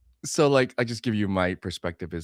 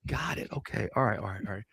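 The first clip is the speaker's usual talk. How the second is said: much softer, resigned murmur; meek quiet acceptance; 2 semitones lower, 9 dB quieter than usual. Retold or imitated. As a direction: quietly give in as the husband. Husband quietly accepting wife's anger.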